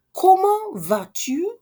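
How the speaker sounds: Comment vas-tu? high and slow, each syllable drawn out; slow high question. Shown as a demonstration. Teacher repeating Comment vas-tu? slowly for learners.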